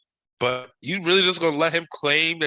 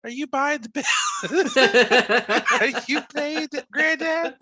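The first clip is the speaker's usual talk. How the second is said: put-on baby voice; 14 semitones higher, 3 dB louder than usual. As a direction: coo it like talking to a baby. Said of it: "you use, like, a baby voice".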